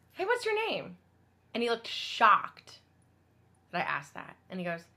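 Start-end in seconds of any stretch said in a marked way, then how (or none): 0.1-1.0 high, lilting friendly question; friendly inquiry. Retold or imitated. Woman asking a hungry man his name, friendly and high. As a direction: ask with a light, raised voice.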